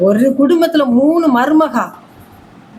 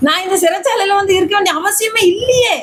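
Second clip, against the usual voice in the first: raised, high cry of injustice; anguished protest; 7 semitones higher than usual. Retold or imitated. Believer voicing Joseph's complaint from prison, raised anguished cry. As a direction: cry out that you never belonged in prison.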